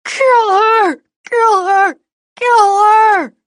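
shrill screaming panic, very high; high-pitched shriek. Acted. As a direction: shriek it in pain. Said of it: "his voice two octaves higher".

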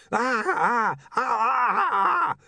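escalating choked cries; vampire scream. Acted. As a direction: cry out in panic at the light. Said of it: "Otto screamed".

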